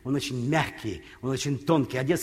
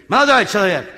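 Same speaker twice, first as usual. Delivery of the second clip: настойчивый громкий окрик; окликнуть в третий раз, громко; крик вслед; 3 semitones higher, 7 dB louder than usual. Retold or imitated. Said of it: "Вдруг сзади слышу крик". (громко кричит вдогонку)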